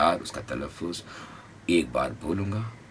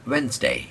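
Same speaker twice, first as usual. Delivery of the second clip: Wednesday higher, WENS lifted; stress on WENS; 6 semitones higher, 3 dB louder, much slower than usual. Shown as a demonstration. Teacher models Wednesday stressed. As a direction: hit veins. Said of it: "veins को उठाएंगे".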